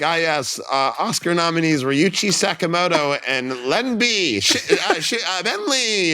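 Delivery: high-pitched mock announcer, stumbling over names; fumbling presenter. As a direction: announce grandly, then trip on the name. Imitating a fumbling name announcer.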